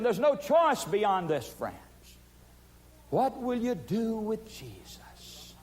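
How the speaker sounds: preaching at full force. Acted. Preacher urging listeners to choose Jesus, full force. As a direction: press the choice on the crowd.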